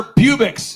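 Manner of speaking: Publix said as "PYOO-bix"; mispronounced store name. Retold or imitated. Speaker proudly thanking his sponsor, mispronouncing Publix as PYOO-bix. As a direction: say Publix wrong, with a long "you" in it. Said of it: "how do you think I think I'm supposed to pronounce it".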